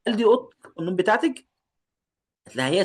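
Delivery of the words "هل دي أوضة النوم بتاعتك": put-on girl's line. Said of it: "هنا معناها هل".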